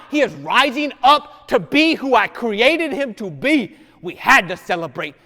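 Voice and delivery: loud, rising sermon delivery; loud gospel storytelling; full-force preaching. Acted. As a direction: preach it out to the pews. Preaching the older son scene.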